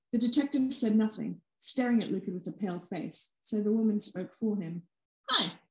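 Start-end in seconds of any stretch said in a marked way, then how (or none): none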